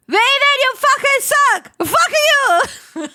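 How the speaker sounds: high, loud furious yell; angry yell. Acted. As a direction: scream it in rage. Angry character yelling at friend.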